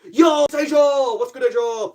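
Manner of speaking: high, loud shout to a friend; excited greeting. Real, not acted. Greeting Asia as she joins the stream, shouted with delight. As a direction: hail a friend who just arrived.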